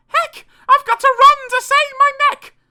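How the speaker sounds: high-pitched panicked shriek; very loud, high yell; shouted. A narrator's reading. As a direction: screech it as Cinderella fleeing. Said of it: "She shouted".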